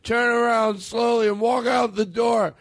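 high, drawn-out mock rant; angry drunken uncle. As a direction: imitate the uncle throwing someone out. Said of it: "that's the anger".

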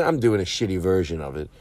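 usual speaking voice

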